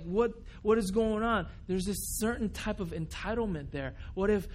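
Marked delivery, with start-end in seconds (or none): 0.0-1.5 higher, louder mock outrage; a fed-up fan yelling at the TV; exasperated fan. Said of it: "He was like".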